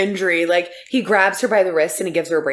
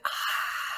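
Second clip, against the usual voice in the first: put-on whispered baby gasp; 14 dB quieter than usual. Baby's wowed gasp voiced.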